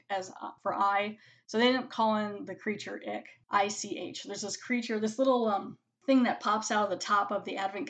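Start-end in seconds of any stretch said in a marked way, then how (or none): none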